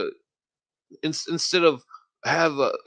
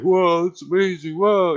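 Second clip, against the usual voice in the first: higher, louder wowed-friend imitation; mock amazement; 5 semitones higher, 5 dB louder than usual. Imitating a stoned friend hearing it all, mock amazed. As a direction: play an easily awed buddy, voice lifted.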